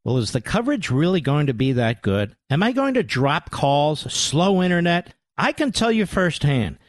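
scripted radio commercial delivery; ad read. Acted. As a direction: sell it smoothly and evenly. Reads a sponsor ad.

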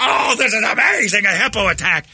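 very high, hammed-up excited shout; mock excited outburst. Host acting out a listener left hanging by the story, mock excited shout. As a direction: squeal it like a thrilled, frustrated listener.

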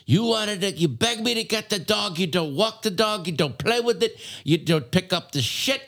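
high mock rant of a fed-up father; imitating a yelling dad. Eddie's dad yelling at him about the dog, mock angry. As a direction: scold the kid in a hammed-up voice. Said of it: "the dad is yelling at Eddie".

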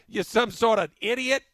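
rough Boston heckle; mock Boston accent. Boston kid heckling the coach, in a mock Boston accent. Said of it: "out of Boston".

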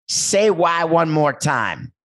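high, drawn-out demand; slow, emphatic movie quote; movie line quote. Acted. Quoting iconic Pulp Fiction line.

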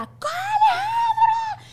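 piercing, very high drawn-out shriek; sudden yell. Acted. Mother suddenly screaming for her child, shrill and comic. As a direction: explode from zero to two hundred. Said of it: "de cero a doscientos".

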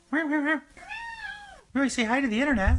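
high-pitched coo to a cat; pet talk. Real, not acted. Host coaxing the cat to greet the internet, high pet talk. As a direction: coax the cat in a squeaky-high voice.

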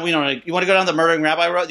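rapid-fire rabbi voice; fast rabbi quote. Retold or imitated. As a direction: rush it out like the rabbi. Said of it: "he did look me in the eyes and say".

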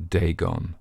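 ordinary delivery, nothing marked

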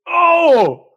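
high, loud shocked yelp; surprised. Real, not acted. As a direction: gasp it out loud. Hears she punched him.

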